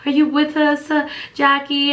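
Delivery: high, coaxing nurse's voice; nurse calling. Retold or imitated. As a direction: nurses reviving her, calling her name. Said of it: "blowing, like".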